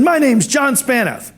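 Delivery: high, drawn-out cheery introduction; John Spanath. Retold or imitated. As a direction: introduce yourself with chirpy cheer. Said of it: "He was very cheery".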